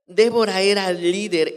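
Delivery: loud, forceful preaching declaration; emphatic repeat. Real, not acted. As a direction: proclaim it boldly to the congregation.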